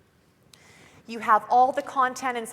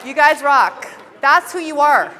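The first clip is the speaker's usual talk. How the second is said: loud, higher, crowd-pumping calls; hyped rally cheer; 5 semitones higher, 10 dB louder than usual. Real, not acted. Speaker firing up the audience after the pledge, loud and excited. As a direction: pump up the whole room.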